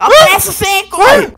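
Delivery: high, loud push to hurry; loud urging. Real, not acted. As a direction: shout him on. Pushing partner to hurry.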